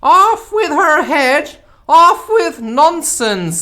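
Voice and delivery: very high, very loud, slow screech; furious screaming Queen. Acted. The Queen screams at Alice in fury. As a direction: scream the sentence like a raging queen. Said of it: "began screaming".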